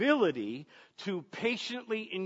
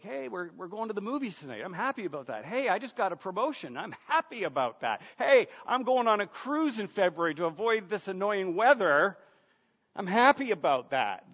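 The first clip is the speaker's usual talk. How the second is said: chirpy, higher-pitched mock excitement, building louder; acted happiness; 4 semitones higher, 4 dB louder, faster than usual. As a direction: share good news with hammed-up glee. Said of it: "Happiness is that feeling we get".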